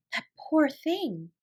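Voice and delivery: slow, tender pity. A narrator's reading. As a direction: hush it out with pity. Sarah pities sleeping maid.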